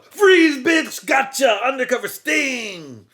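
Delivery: very high, loud shout; shouting cop. Retold or imitated. Cop springing the undercover sting, shouting. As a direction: bark it like a raid bust.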